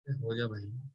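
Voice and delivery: very low, soft aside to himself; muttering to himself. Real, not acted. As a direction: mutter it under your breath while writing. Talking to himself while writing. (धीमे से खुद से बुदबुदाते हुए)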